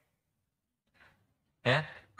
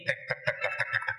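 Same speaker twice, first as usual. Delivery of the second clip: key jingle noise; 8 dB quieter than usual. Retold or imitated. Imitating keys jangling on ring.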